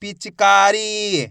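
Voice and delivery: पिचकारी very slow, का and री held; teacher drawing out पिचकारी; blended slow reading. Shown as a demonstration. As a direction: join पिचकारी slowly, stretching the long vowels. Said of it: "मिलके बन जाएगा".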